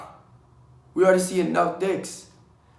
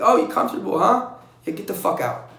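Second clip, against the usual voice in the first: louder, higher mock yell; drill instructor yell; 4 semitones higher, 6 dB louder than usual. Drill instructor yelling at a recruit leaning on a wall, loud. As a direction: bark it like a drill instructor catching a recruit resting. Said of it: "They would yell at you like".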